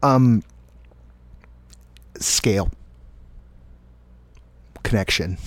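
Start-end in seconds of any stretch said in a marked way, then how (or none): none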